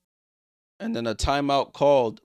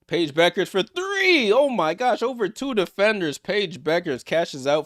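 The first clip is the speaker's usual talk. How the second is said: excited call; 6 semitones higher, 5 dB louder than usual. Real, not acted. Big three-pointer, live call.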